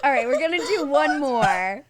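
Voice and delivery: laughing. Real, not acted. Gross jelly bean tasting.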